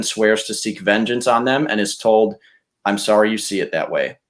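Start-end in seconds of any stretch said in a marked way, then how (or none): none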